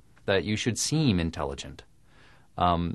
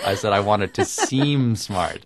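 seem stressed and drawn out, slower; slower than usual. Real, not acted. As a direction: lean hard on seem to correct the record. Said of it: "I never said I am smart".

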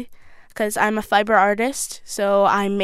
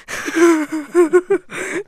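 short laugh; 7 semitones higher, 5 dB louder than usual. Real, not acted. Laughs after the bike story.

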